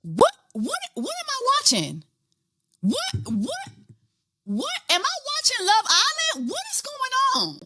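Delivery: very high, soft squeaky what; stunned disbelief. Real, not acted. Watching the twist ending, high and baffled. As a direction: squeal in shocked confusion.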